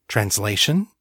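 usual speaking voice

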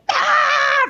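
high, loud frustrated "Ah"; a burst of exasperation; mock frustrated cry; exasperated yell. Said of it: "I'm like".

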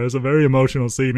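ordinary talk

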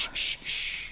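soft whispered shushing; calm her gently; soothing hush. Acted. King soothing the queen.

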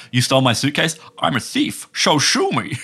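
amateur impressionist's Scottish accent, slushy "sh"; accented movie impression. Co-host trying an impression despite doubts, hammed-up Scottish accent. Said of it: "Steve Coogan or Rob Brydon when it comes to".

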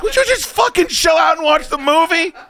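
high, loud mock outburst; retold high yell of impatience; exasperated shout. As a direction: shout her down in hammed-up exasperation. Retold argument, snapping back at girlfriend.

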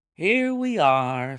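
loud, high, drawn-out cheery announcement. A narrator's reading. Holmes ushering his guest into the room, cheery. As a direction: sing out a slow, cheerful welcome. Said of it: "said Holmes cheerily".

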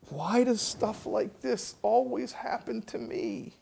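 high, slow, pitiful moan; self-pitying whine. Retold or imitated. Man with head in hands feels sorry for himself, slow high whine. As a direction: wallow like a pathetic victim. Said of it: "I'm a tragic figure".

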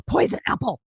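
the answer hits you all at once; gasped eureka moment; gasping realisation. Real, not acted. Sudden guess after hearing fruits.

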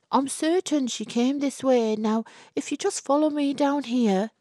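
high, polite persuading voice; young man. Acted. Albert leads Miss Whitehouse away, high and coaxing. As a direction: steer her along politely.

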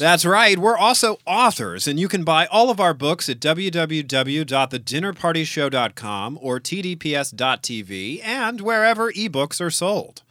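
upbeat ad read; promo read. Acted. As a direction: sell the show brightly.

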